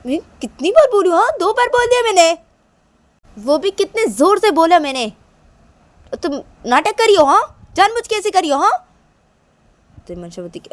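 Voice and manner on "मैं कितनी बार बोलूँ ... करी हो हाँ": loud, high-pitched indignant scolding; exasperated. Acted. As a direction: protest hotly at being made to repeat. Acted quarrel, exasperated at repeating. (झुंझलाकर ऊँची आवाज़ में डाँटना)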